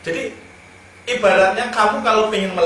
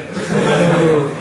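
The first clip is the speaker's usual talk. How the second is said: loud laugh; 6 dB louder than usual. Real, not acted. Laughing at the punchline.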